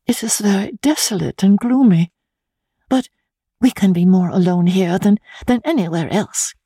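a hint of a troubled young bride confiding; young wife Laura. Young wife confiding in her friend Marion, lightly voiced and earnest.

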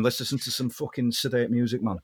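soft, put-on Mancunian rocker voice. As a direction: sign off as a Manchester rock star. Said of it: "So if Jon Snow was [name]".

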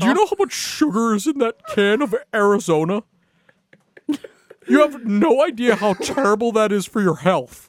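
Cam Prescott. Acted. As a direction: put on a high, loud, hectoring classmate voice. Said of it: "That is literally what he sounds like".

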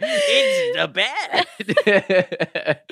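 high, laughing Brooklyn cry; Brooklyn accent. Retold or imitated. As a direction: squeal it in Brooklyn. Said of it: "with a Brooklyn accent said".

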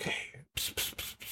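hissed psst to get attention; whispered hey and psst sounds. Retold or imitated. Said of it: "and just whispering".

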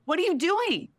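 high, exasperated disbelief; what are you even doing, high and outraged; incredulous. Real, not acted. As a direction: ask it in disbelief. Outraged rhetorical question.

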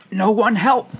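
high, loud choked protest; choking. Acted. Birder with chocolate in the lungs refusing help, choking. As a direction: choke it out on a mouthful gone down wrong. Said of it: "chocolate in my lungs".

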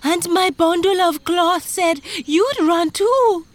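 high, loud wheezed outburst; breathless weaver in a panic; wheezing. A narrator's reading. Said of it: "the weaver wheezed".

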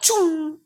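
high whooshing "choom"; imitated zoom-away noise. Parent mimics child bolting from bird, high whoosh. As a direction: voice a cartoonish fast getaway.

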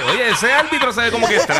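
laughing quote. Retold or imitated. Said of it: "Está el huevón que dice".

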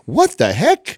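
imitating Tina. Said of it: "that's what she does all the time".

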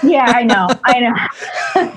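talking through laughter; laughing. Real, not acted. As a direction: laugh while agreeing. Laughing along at shared joke.

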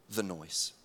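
ordinary talk, nothing marked

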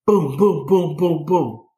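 imitated heavy knocks, evenly punched; door-banging booms. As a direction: pound out the knocks with your voice. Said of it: "big bang on the door".

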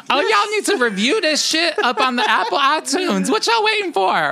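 high, loud hollered demand; mock scolding yell. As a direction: holler at the listeners in hammed-up outrage. Mock scolding appeal to listeners for reviews.

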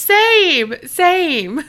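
excited same, same; emphatic agreement. Real, not acted. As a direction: jump in eagerly, then ease off. Agreeing loudly with the speaker.

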